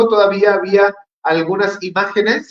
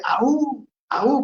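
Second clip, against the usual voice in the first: mock Spartan war cry; 8 semitones higher than usual. Preacher repeating the war cry from 300 with a high mock yelp. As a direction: yelp "Aú" twice like a soldier in the film. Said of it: "el mismo grito de".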